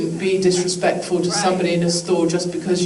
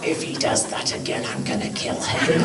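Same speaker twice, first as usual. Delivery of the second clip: low, hushed seething threat; muttered threat; 6 semitones lower, 5 dB quieter than usual. Acted. Fed-up spouse venting about partner, muttered threat. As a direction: mutter it low under the breath, half-voiced.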